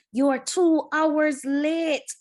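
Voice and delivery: loud and slow, dragging out the complaint; angry cry. A narrator's reading. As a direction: shout at the late driver, slowly. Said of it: "someone cried".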